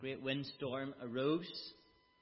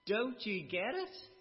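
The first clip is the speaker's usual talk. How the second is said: higher, louder exasperated rebuke; rebuking sailors; 5 semitones higher, 5 dB louder than usual. Retold or imitated. Sailors scolding runaway Jonah, exasperated and loud. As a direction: scold him for being so foolish.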